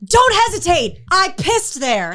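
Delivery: high, loud; mock urging. Teammate at the dunk wall urging her on. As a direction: call it out bossily. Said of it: "she goes".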